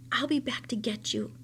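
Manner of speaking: secret whisper. Acted. Boy whispering a secret promise to the rabbit. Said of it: "he told Edward".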